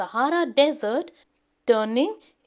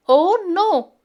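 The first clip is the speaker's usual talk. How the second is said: high, loud alarmed oh no; sudden worried exclamation; 7 semitones higher, 7 dB louder than usual. Acted. Dad sees snow falling.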